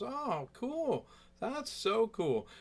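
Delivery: high, drawn-out delighted reaction; slow, high-pitched approval; B B King. Retold or imitated. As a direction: imitate a jovial star, pleased and impressed. Retells star's delighted reaction to fans.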